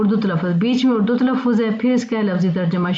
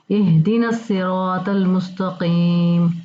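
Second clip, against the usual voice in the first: slow recitation; much slower than usual. Shown as a demonstration. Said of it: "अब देखें".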